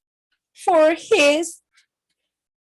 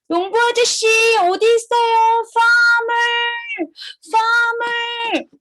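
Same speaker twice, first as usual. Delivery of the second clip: high, sing-song call; calling the farmer; 6 semitones higher, much faster than usual. Acted. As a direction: call out for someone far away. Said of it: "Call the farmer first".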